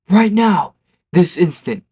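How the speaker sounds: high, loud retold command; screaming teacher. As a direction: yell the order like a furious teacher. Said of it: "screams. He's like".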